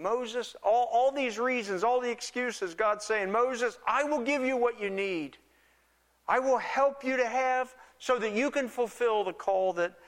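full-voiced pulpit intensity; rising preacher's fervor. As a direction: build it up into a passionate proclamation. Preacher repeats God's promise, building.

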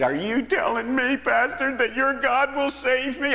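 high, loud, pleading cry; a man at the altar crying out for hope; desperate plea. Retold or imitated. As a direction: beg for the answer in disbelief.

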